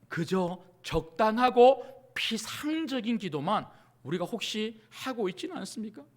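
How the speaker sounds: ordinary talk, nothing marked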